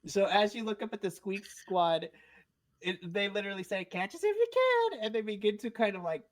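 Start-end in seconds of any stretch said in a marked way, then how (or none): 3.9-5.1 much higher, louder, fast squeaky taunting; cheeky little creatures daring them to chase; tiny creature taunt. Acted. Squeak Squad taunting the fallen players, squeaky and high.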